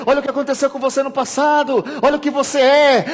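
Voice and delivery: high, loud, insistent tempter; preacher acting out a taunting devil; nagging devil. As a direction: pester them like an accuser at the ear. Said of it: "o diabo fica na nossa orelha falando".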